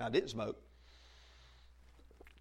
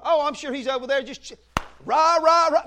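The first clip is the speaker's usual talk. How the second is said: mock cheerleading; 9 semitones higher, 10 dB louder than usual. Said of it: "mocking the media's cheerful version".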